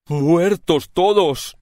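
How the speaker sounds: usual speaking voice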